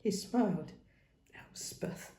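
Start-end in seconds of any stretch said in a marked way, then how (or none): 1.3-2.1 soft whispered name. Acted. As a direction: breathe her name tenderly. Fond inner thought.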